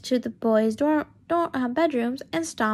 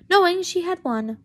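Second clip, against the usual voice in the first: excited; 7 semitones higher, 5 dB louder than usual. A narrator's reading. Narration suddenly loud, excited.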